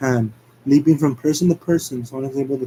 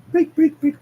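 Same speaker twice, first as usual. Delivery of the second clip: high loud chanting; frantic urging; 14 semitones higher, 4 dB louder than usual. Acted. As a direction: urge it on frantically. Sonic urging bottle.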